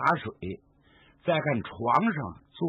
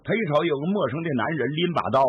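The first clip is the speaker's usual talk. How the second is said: usual speaking voice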